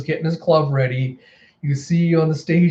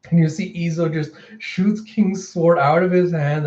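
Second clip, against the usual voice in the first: normal talking voice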